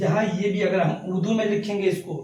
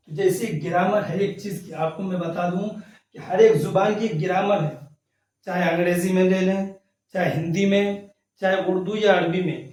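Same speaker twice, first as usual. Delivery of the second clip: usual speaking voice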